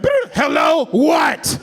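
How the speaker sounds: high, loud, annoyed pickup; mock irritated 911 operator; rude cop answering. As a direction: bark it like a bothered dispatcher. Cop rudely picking up phone.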